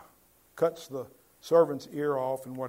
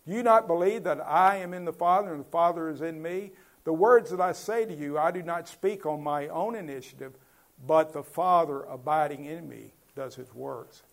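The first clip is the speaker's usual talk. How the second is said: scripture reading; 3 semitones higher, 5 dB louder than usual. Acted. Reading Jesus' words aloud.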